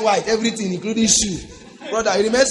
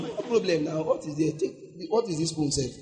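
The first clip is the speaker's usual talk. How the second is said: soft, low, unbothered threat; no need to shout; 3 semitones lower, 6 dB quieter than usual. Acted. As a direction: warn the robber quietly, without any fuss. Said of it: "It doesn't have to be".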